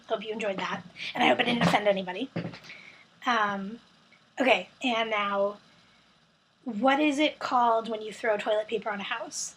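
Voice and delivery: ordinary talk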